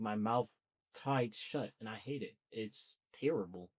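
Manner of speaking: ordinary delivery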